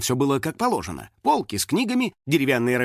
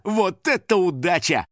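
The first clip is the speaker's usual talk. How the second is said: ordinary delivery, nothing marked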